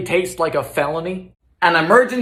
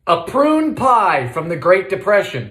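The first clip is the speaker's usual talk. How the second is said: ordinary talk, nothing marked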